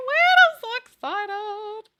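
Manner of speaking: very high, breathless fan squeal. Real, not acted. Streamer squealing to chat about the dragon movie, giddy.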